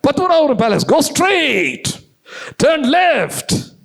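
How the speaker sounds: loud, very high-pitched shouted directions; over-the-top confident shouting, hammed up; clever student's directions. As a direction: belt out each direction to the foreigner. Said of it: "உன்னை இவன் புத்திசாலி தானே சொல்லுவான்".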